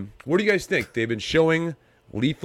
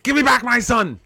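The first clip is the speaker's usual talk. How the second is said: high, loud, desperate movie shout; Mel Gibson impression; 11 semitones higher, 4 dB louder than usual. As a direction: yell it like a frantic father. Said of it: "that was Ransom".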